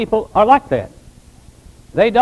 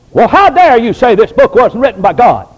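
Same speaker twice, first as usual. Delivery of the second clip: loud, indignant challenge imitating offended believers; hammed-up huffy protest; mock outrage; 3 semitones higher, 8 dB louder than usual. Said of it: "we get all ruffled and fired up".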